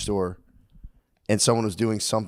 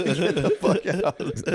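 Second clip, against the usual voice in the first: higher, slow, laughing; laughing dismissal; 5 semitones higher, slower than usual. Real, not acted. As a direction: laugh it away. Laughing at the idea.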